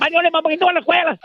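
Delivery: usual speaking voice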